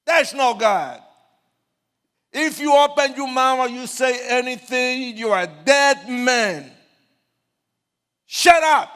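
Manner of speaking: loud high furious yell; furious shouted threat; angry gang shout. Retold or imitated. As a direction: threaten him like a gang leader. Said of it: "And I say".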